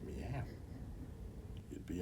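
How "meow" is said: quiet meow. Retold or imitated. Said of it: "All I heard was".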